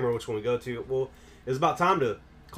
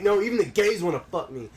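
gloating brag; 7 semitones higher, 4 dB louder than usual. Retold or imitated. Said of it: "I was like".

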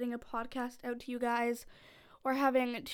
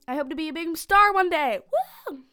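louder, building to a whoop; excited boast; 3 semitones higher, 8 dB louder than usual. Real, not acted. Young actress tells listeners her star dream, excited. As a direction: finish on a loud, gleeful cheer.

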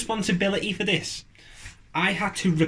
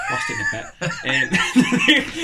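laughing; 12 semitones higher, 4 dB louder, much slower than usual. Real, not acted. Joke punchline laughing.

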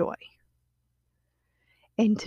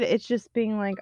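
ordinary talk, nothing marked

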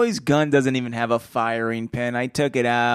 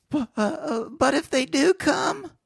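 put-on frightened boys; 11 semitones higher than usual. Boys' frightened question.

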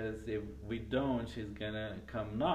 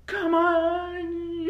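high, very loud wailing cry; loud high-pitched mock shriek; imitated scream; 11 dB louder than usual. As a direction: belt it out in mock dismay. Imitates woman bursting into room.

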